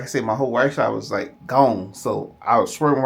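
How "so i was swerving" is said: slurred, dragging words; imitating his slurred talk after the stroke; slurred stroke speech. Man telling his mother, imitating slurred post-stroke speech. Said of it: "I was talking like that".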